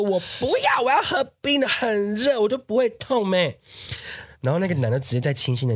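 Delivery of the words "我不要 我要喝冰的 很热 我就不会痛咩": put-on high, whiny girlfriend voice; friend's whiny protest. Friend telling her boyfriend she wants iced tea, high and whiny. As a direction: whine it up high like a sulky teen girl. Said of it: "然后我朋友就说".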